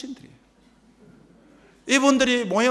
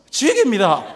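louder, clipped-short Busan praise; blunt Gyeongsang verdict; 5 dB louder than usual. Retold or imitated. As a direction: give it the gruff Busan one-word rave. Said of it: "경상도 사람은요".

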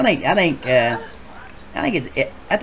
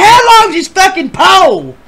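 high, loud comic yell; hammed-up incredulous shout; shouted punchline; 10 dB louder than usual. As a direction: holler the question like a punchline. Acts out shouted punchline, laughing.

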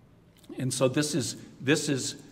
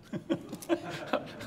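soft airy laugh, pitched up; breathy giggle; 13 semitones higher, 10 dB quieter than usual. Real, not acted. Laughing at the confessor's advice, a soft high giggle. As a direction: let a quiet high laugh escape.

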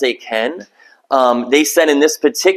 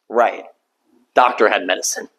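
low, soft and deadpan, as the Lord; the Lord answering with a dry, quiet irony; dry sarcasm; 8 semitones lower, 4 dB quieter than usual. Acted. God's dry reply, acted.

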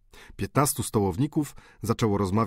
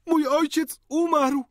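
high, slow anguished cry; 4 dB louder, slower than usual. A narrator's reading. Countess crying out over her dead father, high and anguished. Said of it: "krzyknęła hrabina".

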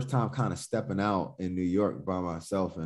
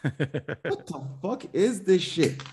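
rising higher word by word, then a slow stunned question; retold disbelief; 7 semitones higher, much slower than usual. Telling a friend how the Bad Brains video hit him, incredulous high-pitched retelling.

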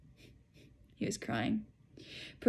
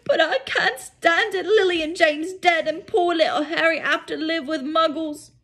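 loud, high grief-stricken wail; Hagrid wailing; 9 semitones higher, 7 dB louder than usual. Acted. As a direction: cry it out in despair.

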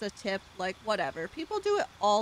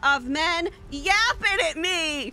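mock rant; 8 semitones higher, 9 dB louder, slower than usual. Ranting at game men, shutting them up.

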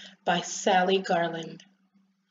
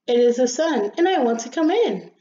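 louder, lifted, cheerful little sun; cheery voice; 3 semitones higher, 5 dB louder than usual. Acted. Sun asks the rosebud to let it in, cheerily. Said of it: "said a soft and cheery voice".

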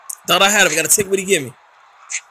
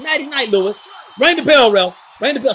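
usual speaking voice

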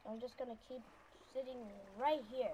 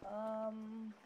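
ordinary delivery

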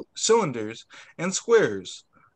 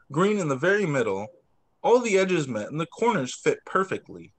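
plain reading voice

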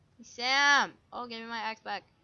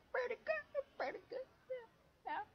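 mock squeaky whine; 14 semitones higher, 10 dB quieter than usual. Mock whiny high voice.

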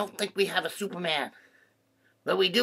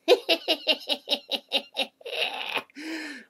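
long, high giggle; high tittering laugh; giggling fit; 10 semitones higher than usual. Acted. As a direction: giggle on and on until out of air. Amused by the duck sound.

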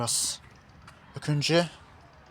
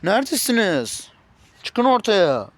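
calling out; 5 semitones higher, 4 dB louder, slower than usual. Acted. Calling out for missing family.

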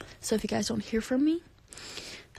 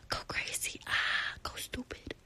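ASMR whisper; 13 dB quieter than usual. Pretend. Hyping fans in a soft ASMR whisper. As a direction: breathe the words close to the mic. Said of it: "A S M R".

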